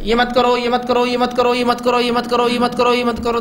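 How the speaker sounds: monotone, endlessly repeated prohibition; mock parental nagging. Parents constantly forbidding a child, mock nagging. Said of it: "बच्चा इतना उसको बोलते रहते हैं".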